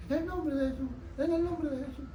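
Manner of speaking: thin, high, strangled attempt to speak; acting out a choked-off voice, soft and strained; voice blocked. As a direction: squeeze out a high, weak imitation of a lost voice. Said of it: "me paró la voz".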